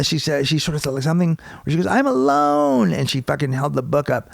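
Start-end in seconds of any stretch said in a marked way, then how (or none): 1.9-2.9 high, drawn-out mock wail; mocking awful voice. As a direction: stretch it into a pitiful high whine. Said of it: "her voice was just awful".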